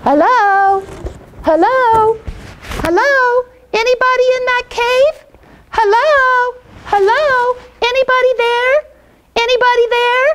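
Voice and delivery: put-on high child voice calling out into a cave; a small boy hollering hello into the dark; little boy calling. Little Jackie Paper calling into Puff's cave, high child voice.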